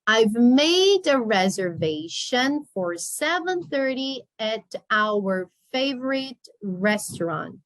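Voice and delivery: slowed down, words drawn out; slow model reading. Shown as a demonstration. Teacher repeats the sentence slowly for the class. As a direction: read it slowly for the learners.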